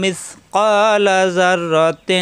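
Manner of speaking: slow melodic tajweed chant; Quran recitation. Shown as a demonstration. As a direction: draw out each vowel in recitation.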